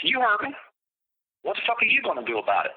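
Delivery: mock him fast and high; taunting Brian. Brian mocking the biker, high and quick. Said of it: "He goes".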